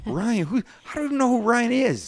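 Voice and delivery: high incredulous questioning; baffled. Real, not acted. Host baffled by an unknown boss's name, high and incredulous. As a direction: sound completely lost and amazed.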